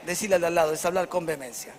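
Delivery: ordinary talk, nothing marked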